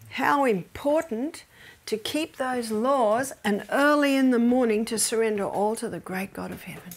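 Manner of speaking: ordinary talk, nothing marked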